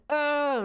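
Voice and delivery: high strained grunt; pushing grunt. Retold or imitated. Mom retelling the delivery room, high straining grunt. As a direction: bear down with a grunt. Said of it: "I'm like".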